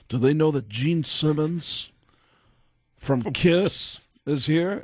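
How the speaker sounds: slow, lordly lightly voiced Gene; [name] impression. Gene demanding the studio, slow impression. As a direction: drag out the star's self-importance. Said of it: "I did this impression of Gene".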